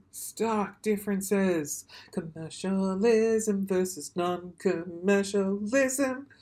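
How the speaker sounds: drawn-out, deliberate words; slow, heavy emphasis; slow emphatic. Shown as a demonstration. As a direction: spell it out slowly for weight.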